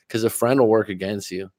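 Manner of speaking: ordinary talk, nothing marked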